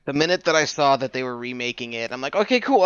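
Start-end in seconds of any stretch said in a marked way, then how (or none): 2.3-2.8 mildly excited, higher pitch. Retold or imitated. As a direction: retell a pleased first reaction. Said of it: "I got excited".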